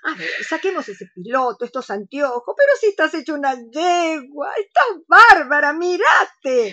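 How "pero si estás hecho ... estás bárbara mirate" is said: high delighted compliments; rising excited flattery of her shy friend; excited praise. Acted. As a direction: gush over her friend. Admiring friend's new look.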